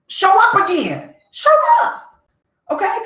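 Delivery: high-pitched mock dare; acted, worked-up taunt, way up high; daring challenge. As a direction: squeal out a defiant challenge. Acted dare to imagined intruder.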